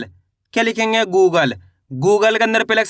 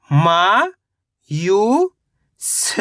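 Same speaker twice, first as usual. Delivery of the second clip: sound by sound; much slower than usual. Shown as a demonstration. Teacher breaking a word down for learners, syllable by syllable. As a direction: sound out मा ... यू ... स with pauses. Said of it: "तो मिलके बन जाएगा".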